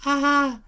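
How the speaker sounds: sudden high-pitched mock scream; a quick shrill cry while being pulled upward; retold scream. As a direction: yelp it high as she gets sucked up. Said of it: "she yells".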